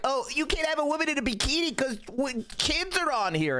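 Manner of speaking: high, mocking imitation of a prudish critic. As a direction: sneer it out in their whiny voice. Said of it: "It's be like".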